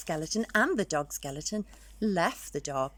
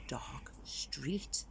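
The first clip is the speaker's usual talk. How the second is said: spooky hushed whisper; eerie storyteller whisper; 3 semitones lower, 10 dB quieter than usual. Acted. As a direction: breathe it like a ghost story.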